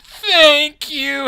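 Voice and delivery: high, loud blubbered thanks; mock sobbing. Imitating a tearful cosplayer thanking the artist, mock sobbing. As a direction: wail it like an overwhelmed fangirl. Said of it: "started sobbing and being like".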